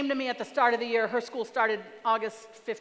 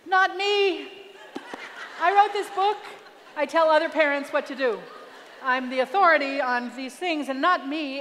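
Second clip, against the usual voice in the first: indignant inner voice; 3 semitones higher, 5 dB louder than usual. Retold or imitated. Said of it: "is what I'm thinking".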